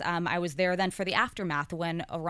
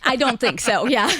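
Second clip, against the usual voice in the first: laughing; 6 semitones higher, 9 dB louder than usual. Real, not acted. Laughing at conservative question.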